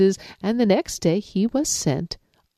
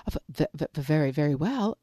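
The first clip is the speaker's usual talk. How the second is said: much softer, stuttering scared rabbit; stammering fear; 4 dB quieter, faster than usual. Acted. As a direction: stutter it out, terrified.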